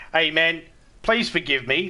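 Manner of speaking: ordinary talk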